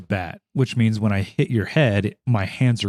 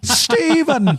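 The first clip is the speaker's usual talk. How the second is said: high excited cry; 4 dB louder than usual. Pretend. Mock reunion cry for Steven.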